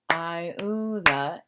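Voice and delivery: "Iuda" drawn out in three separate syllables. Shown as a demonstration. Teacher counting syllables for students, "Iuda" slowly broken up. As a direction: stretch each syllable of "Iuda". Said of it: "is three syllables".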